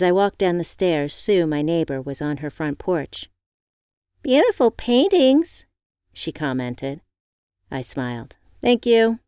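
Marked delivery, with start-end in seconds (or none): 4.2-5.7 high, slow admiring neighbor; neighbor woman voice. Acted. Neighbor Sue compliments. 8.6-9.2 high, loud cheerful thanks; bright thank you. Acted. Evie thanks neighbor.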